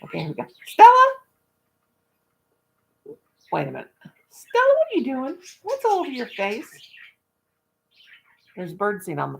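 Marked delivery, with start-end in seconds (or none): 0.6-1.1 loud high shout of the name; calling the dog. Real, not acted. Owner shouting at her dog, loud high call. 4.3-6.7 scolding pet. Real, not acted. Owner catching her dog in the birdseed, high startled scold.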